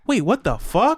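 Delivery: mock kid going wait what; kid discovering it. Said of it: "and they're like".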